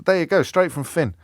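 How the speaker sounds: ordinary talk, nothing marked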